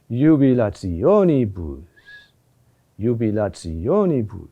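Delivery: JUBILATIONIBUS climbing to a peak on the O, then falling away; increase, then decrease. Shown as a demonstration. Teacher demonstrating both phases of the word, "jubilationibus" rising to O and falling. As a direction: build through "jubilationibus" to the O and let the ending subside. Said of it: "a phase of increase".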